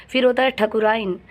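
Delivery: ordinary delivery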